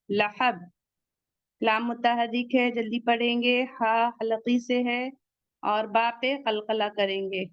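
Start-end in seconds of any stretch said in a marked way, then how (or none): none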